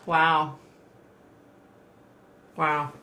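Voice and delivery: usual speaking voice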